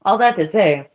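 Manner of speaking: ordinary talk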